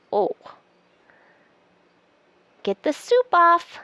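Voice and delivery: usual speaking voice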